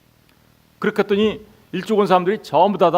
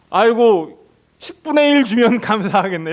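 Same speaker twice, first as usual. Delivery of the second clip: high, louder, chuckling laborer's voice; grateful laborer; 4 semitones higher, 4 dB louder than usual. Acted. A five o'clock worker marvelling at his luck, chuckling. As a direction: play a tickled worker who expected little.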